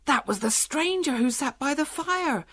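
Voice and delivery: high-pitched exclamation; excited cry. A narrator's reading. Shepherd suddenly recognises the prisoner's description, crying out. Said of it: "cried Fennel".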